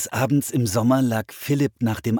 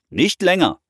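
loud, raised parental shout; calling back; 10 semitones higher, 7 dB louder than usual. A narrator's reading. Father answers his children from afar, calling back loudly. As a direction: call a firm limit across the house. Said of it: "rief ihr Vater zurück".

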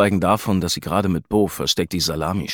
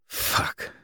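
sharp whispered curse; hissed curse; 7 dB quieter than usual. A narrator's reading. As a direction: hiss the curse under breath. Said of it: "zische ich".

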